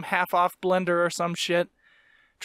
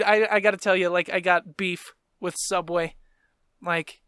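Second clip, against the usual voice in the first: normal talking voice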